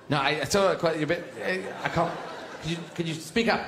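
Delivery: usual speaking voice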